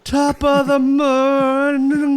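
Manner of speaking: loud, high, drawn-out slurring; ridiculing a botched Irish accent; mock stroke Irish. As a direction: mangle an Irish greeting slowly and loudly. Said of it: "first on-air stroke in podcast history".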